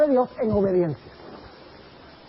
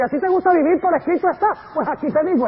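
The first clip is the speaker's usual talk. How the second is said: mocking devil; 6 semitones higher, 5 dB louder than usual. Preacher voices the devil mocking.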